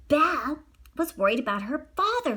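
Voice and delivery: ordinary delivery, nothing marked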